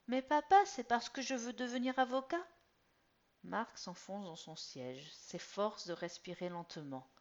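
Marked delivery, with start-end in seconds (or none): 0.1-2.4 put-on high, loud eager boy; pleading teen. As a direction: insist to dad. Son pleading with father.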